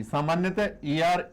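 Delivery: ordinary talk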